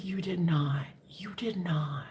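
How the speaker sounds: breathless whispered "you did not"; whispered denial. Retold or imitated. Whispering disbelief at the man who claims he heard her. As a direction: hiss it in disbelief.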